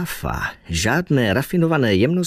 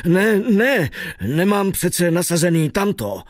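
higher-pitched, lightly acted agitation; agitated protest; 6 semitones higher than usual. A narrator's reading. Driver objecting to his passenger, agitated. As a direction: protest urgently, voice lifted. Man protests in alarm, after crying out.

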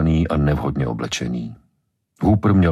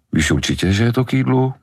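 teasing, rising high at the end; 8 semitones higher than usual. A narrator's reading. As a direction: needle her about the soup. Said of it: "škádlil ji". (Brody škádlí Ellen, posměšně)

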